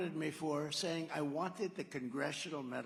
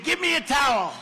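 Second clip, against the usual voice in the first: higher, much louder mock demand; mock shout; 10 semitones higher, 16 dB louder than usual. To the crowd, joking about demanding a towel after landing, mock shout. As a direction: bellow it like a panicked passenger.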